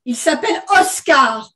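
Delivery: barked. Acted. Teenage daughter jumping up at her mother, barking. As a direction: bark the name as a correction. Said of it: "aboya l'adolescente".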